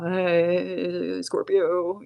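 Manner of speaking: quavering, nervous mock greeting; shaky, scared greeting. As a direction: imitate your own trembling on-camera hello. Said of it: "I was shaking".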